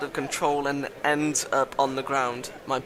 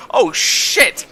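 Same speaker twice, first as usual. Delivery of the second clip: high, loud startled yell; big high-pitched outburst; alarmed shout; 10 dB louder than usual. Real, not acted. Startled outburst during the game.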